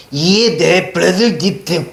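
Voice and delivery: 브레들 read letter by letter, halting and garbled; wrong choppy reading. Shown as a demonstration. Korean teacher shows students how not to read the old text, choppy misreading. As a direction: stumble through 브레들 as separate literal syllables. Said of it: "이렇게 읽으면 안 된다고".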